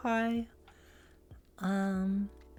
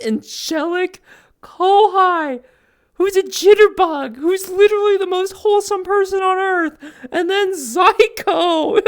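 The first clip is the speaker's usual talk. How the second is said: excited gushing; 13 semitones higher, 11 dB louder than usual. Real, not acted. Streamer gushes about her angelic friend versus a bad influence, squealing high and loud.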